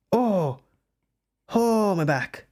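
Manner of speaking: high pained groan. Real, not acted. Streamer's back hurts, real pained exclamation. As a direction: cry out from a sore back. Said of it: "that hurt".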